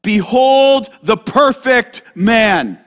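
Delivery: loud, slow, solemn declaration; preaching proclamation. Acted. Preacher proclaiming Jesus to his church, loud and slow. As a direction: proclaim it to the congregation. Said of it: "preacher's own proclamation".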